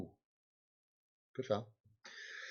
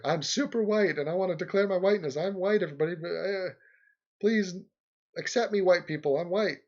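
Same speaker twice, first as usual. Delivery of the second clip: mock declaration; 7 semitones higher, 4 dB louder than usual. Mocking an imagined person who declares their whiteness, higher and louder. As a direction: ham up an eager plea to be accepted.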